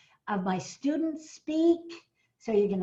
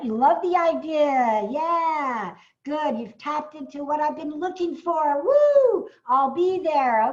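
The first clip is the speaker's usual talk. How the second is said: excited cheering; 4 semitones higher, 6 dB louder than usual. Real, not acted. Cheering an attendee's comment.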